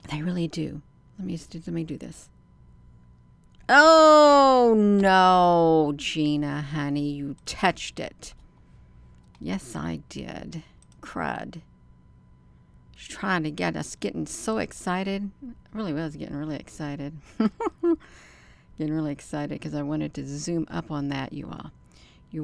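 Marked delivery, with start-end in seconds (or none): 3.7-8.3 real dismay as the view is lost; sudden loud cry of alarm; alarmed outcry. Real, not acted. Gina touched the screen. 17.2-18.1 short high burst of laughter; quick giggle, high and bright. Real, not acted. Laughing at her own excitement.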